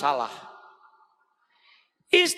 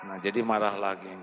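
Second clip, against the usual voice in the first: ordinary delivery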